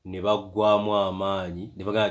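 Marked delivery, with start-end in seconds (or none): none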